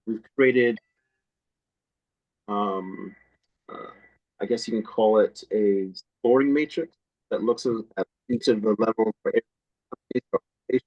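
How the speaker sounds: breaking up on a bad line; bad connection. Real, not acted. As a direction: voice cutting out over a call. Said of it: "So you're, you're cutting out there".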